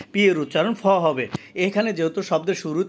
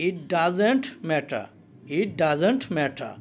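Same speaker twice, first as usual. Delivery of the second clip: It doesn't matter, very slow, dropping the final r of matter; slow demo reading; much slower than usual. Shown as a demonstration. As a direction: say it slowly twice, matter ending open. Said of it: "এটার অর্থ হলো". (খুব ধীরে, matter-এর শেষের র বাদ দিয়ে)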